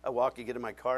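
ordinary talk

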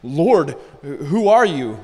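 loud, startled cry to the Lord; mock freak-out. Blinded Paul calls to the Lord, mock panicked. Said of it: "he's freaking out".

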